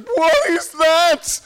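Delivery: loud, high, drawn-out wailed question; weeping cry. Retold or imitated. Imitating the weeping girl on the train, high loud cry. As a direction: imitate a distraught girl crying out. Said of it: "she's weeping".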